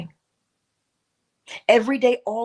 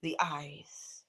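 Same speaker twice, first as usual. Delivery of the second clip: breathy whispered opening; hushed whisper; 6 semitones lower, 14 dB quieter than usual. Shown as a demonstration. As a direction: whisper it like a secret. Whispered intro to the eyes.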